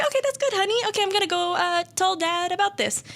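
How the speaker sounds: very high-pitched imitation of a placating mother; humouring mom. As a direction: play a mom nervously going along, way up high. Mom humours her crazy son.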